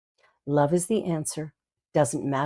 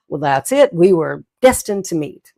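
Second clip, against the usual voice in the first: louder, beaming mock declaration; playful delight; 8 dB louder than usual. Teasing the stranger in the aisle, louder and delighted. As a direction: announce fate to a stranger with glee. Playful retold drugstore banter.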